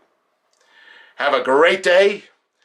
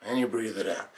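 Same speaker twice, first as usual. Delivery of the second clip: much softer, lower exhale cue; breathing-out cue; 5 semitones lower, 13 dB quieter, faster than usual. Acted. Meditation leader cueing listeners to exhale, hushed and low. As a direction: let the voice sink away with the breath.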